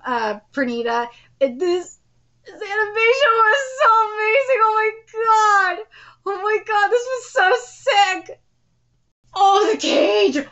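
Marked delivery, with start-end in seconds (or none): 2.5-10.5 excited high cries. Real, not acted. Ecstatic reaction to animation.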